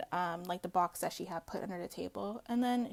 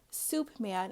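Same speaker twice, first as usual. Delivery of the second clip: ordinary delivery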